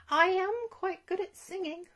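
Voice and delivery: put-on young dragon volunteering to sing; shy dragon voice. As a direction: pipe up eagerly though shy.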